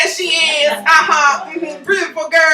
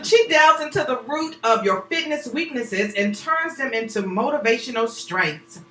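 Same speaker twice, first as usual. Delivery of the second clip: ordinary talk, nothing marked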